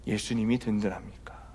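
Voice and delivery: a sudden whisper after the spoken line; whispered question. Real, not acted. Pastor asks the congregation in a whisper. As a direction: drop to a hushed whisper to make it land.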